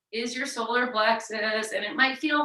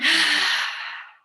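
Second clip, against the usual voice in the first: loud rush of breath out; forceful pumped exhale; 7 semitones higher, 6 dB louder than usual. Shown as a demonstration. Yoga teacher demonstrating breath of fire slowly, forceful exhale. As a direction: push all the air out hard. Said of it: "pull in".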